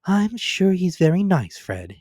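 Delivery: high, coaxing little hint; sweetly hinting young wife; hinting niece. A narrator's reading. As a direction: nudge him gently. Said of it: "hinted Scrooge's niece".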